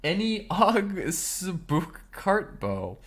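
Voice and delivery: slow careful read of made-up words; fake foreign language. Real, not acted. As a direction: sound out Any og spook Kartbo. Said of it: "communicating in this fake language".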